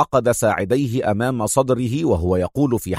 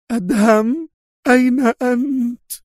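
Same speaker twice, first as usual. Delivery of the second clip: much higher desperate call; mild mock scream; 12 semitones higher than usual. Mona calling for Adham as she falls, high and desperate. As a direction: call out for help. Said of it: "وصرخت بكل قوتها".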